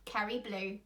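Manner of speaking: ordinary delivery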